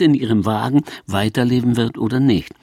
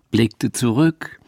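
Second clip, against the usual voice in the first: ordinary talk, nothing marked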